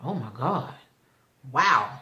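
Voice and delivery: low, hushed imitation of being stunned; acted quiet disbelief. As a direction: drop it down, softly dumbstruck. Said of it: "Lucas is stunned".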